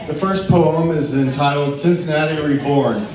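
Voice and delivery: normal talking voice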